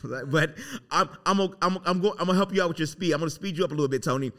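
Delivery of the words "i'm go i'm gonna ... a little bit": normal talking voice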